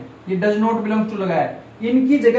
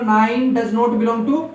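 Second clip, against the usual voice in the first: slow dictation; much slower than usual. Shown as a demonstration. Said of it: "क्या लिखूंगा?".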